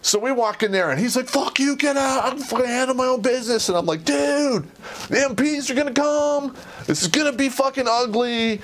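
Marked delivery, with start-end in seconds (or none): 1.3-3.7 higher-pitched mock angry drunk; drunk yelling. Drunk sergeant telling the soldiers to get out, mock angry. 4.0-8.6 high, pressing warning; urgent plea. Retold or imitated. Soldier urging his drunk buddy before the MPs come, pitched high.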